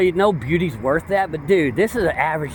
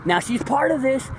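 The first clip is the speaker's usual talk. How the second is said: ordinary talk, nothing marked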